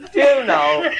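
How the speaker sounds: can barely get the words out for laughing; laughing through a quote. Retold or imitated. Telling the farmer's story, giggling through his words.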